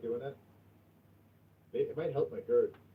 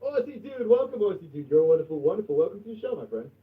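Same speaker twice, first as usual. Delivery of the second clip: excited welcome; 6 semitones higher, 5 dB louder than usual. Real, not acted. Greeting a new viewer excitedly.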